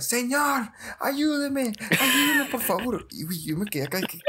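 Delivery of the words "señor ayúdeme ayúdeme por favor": high, pleading yell for help; retelling a friend screaming for help from the car; desperate cry for help. Retold or imitated. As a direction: beg a stranger for help, panicked. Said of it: "le empieza a gritar".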